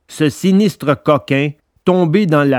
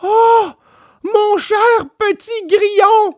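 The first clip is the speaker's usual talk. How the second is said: high, delighted puppet voice; Pinocchio greeting. A narrator's reading. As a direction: greet an old friend politely.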